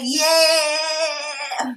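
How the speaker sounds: loud, high, long-drawn "yeah"; super excited cheer. Retold or imitated. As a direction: let out a drawn-out whoop of excitement. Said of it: "I think I should just calm down".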